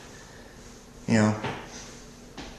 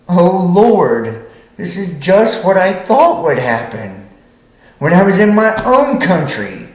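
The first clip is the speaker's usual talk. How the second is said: praying Jonah; 5 semitones higher, 5 dB louder than usual. Retold or imitated. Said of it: "He prayed to the Lord and said".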